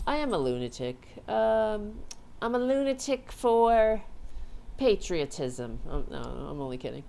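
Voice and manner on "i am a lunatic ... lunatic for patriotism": loud, hammed-up lunatic proclamation; raised voice, playfully over the top; mock-fervent declaration. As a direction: proclaim it with mock patriotic zeal. Hamming up being a lunatic.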